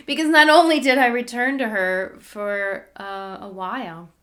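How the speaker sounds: usual speaking voice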